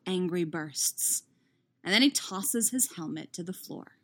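plain reading voice

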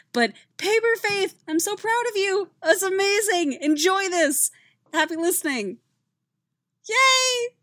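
high, loud, gushing congratulations; excited. Real, not acted. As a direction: squeal with joy for her friend.

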